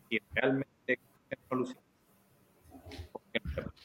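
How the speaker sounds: words cut up and fading out on the line; breaking up over a bad connection; call dropping out. Real, not acted. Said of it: "te estás cortando".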